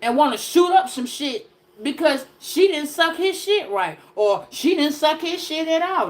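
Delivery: ordinary talk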